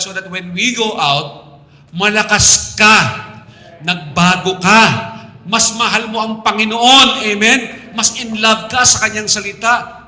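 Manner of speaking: high, loud, fervent sermon delivery; impassioned pulpit shout; full-force preaching. Real, not acted. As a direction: preach it out loud, pitched high, driving each point home.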